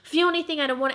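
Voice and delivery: high, fast frantic no's; refusing the vision, frightened; panicked refusal. Retold or imitated. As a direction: push the feeling away in a panic. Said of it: "I'm like".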